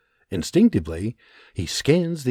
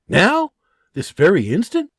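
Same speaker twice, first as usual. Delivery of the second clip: high startled question; astonished high query; surprised; 8 semitones higher, 5 dB louder than usual. Acted. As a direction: blurt out in disbelief. John startled by urgency.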